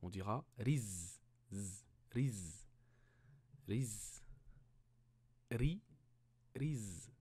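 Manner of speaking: riz with no vowel after the z; sukun drill. Shown as a demonstration. Teacher showing sukun to a pupil. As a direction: clip riz short on the z. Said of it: "On le prononcera tel quel".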